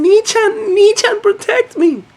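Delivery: very high, loud squeal mimicking an anime sister; mock little-sister voice. Sister hugging her brother for protection, mock high squeal. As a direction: squeal it in an exaggerated high-pitched plea. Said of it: "to hug him again, saying".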